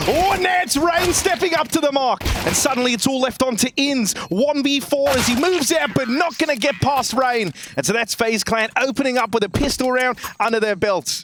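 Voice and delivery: high-pitched, excited Aussie esports caster; Australian accent play-by-play. Acted. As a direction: call the action in a hammed-up Australian accent. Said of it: "playing in an accent must be very tough".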